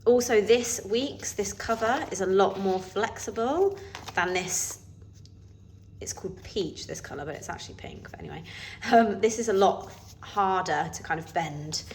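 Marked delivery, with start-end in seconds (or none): 6.0-8.4 whispered aside. Real, not acted. Aside about the colour name.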